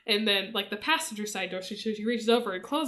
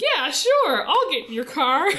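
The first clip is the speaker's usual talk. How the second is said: mock eager yes; 5 semitones higher, 6 dB louder than usual. Naive girl accepts a ride.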